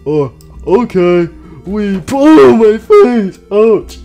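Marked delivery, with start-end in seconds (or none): 1.7-4.0 loud, high yell of pain; pained outcry. Acted. Withered Freddy hits the cabinet, pained yelling. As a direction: cry out as if you just hit your face.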